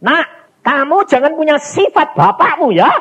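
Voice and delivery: put-on a mother scolding her child, high and earnest; mother's warning.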